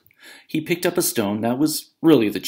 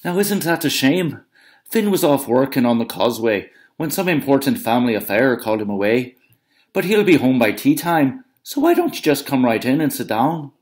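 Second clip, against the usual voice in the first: higher, sugary giant's wife; Una's sweet voice; 5 semitones higher, 5 dB louder than usual. Acted. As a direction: lure him in with honeyed politeness. Una voiced through story.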